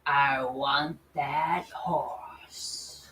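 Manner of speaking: slow, low whispered greed; villainous whisper. Acted. CEO in the stands secretly coveting the horse, whispered. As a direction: breathe it like a scheming boss.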